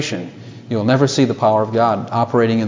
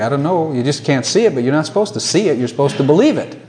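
ordinary talk

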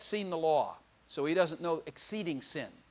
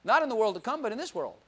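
normal talking voice

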